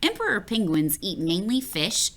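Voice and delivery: plain reading voice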